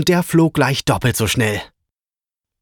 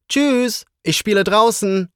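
high, bright-pitched farewell call; calling out goodbye; 10 semitones higher than usual. A narrator's reading. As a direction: call goodbye to Mama in a much higher voice. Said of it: "rief er Mama zum Abschied laut zu".

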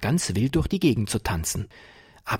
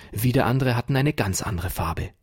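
usual speaking voice

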